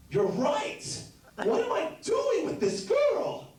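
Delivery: high, slow, giddy squeaky reply; sudden realization. Retold or imitated. Joking answer to friend's late-night call, squeaking high. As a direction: squeal with comic epiphany.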